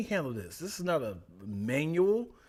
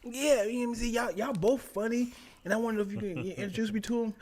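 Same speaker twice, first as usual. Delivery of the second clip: forced casual; 8 semitones higher, faster than usual. Retold or imitated. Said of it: "I'm trying to be cool".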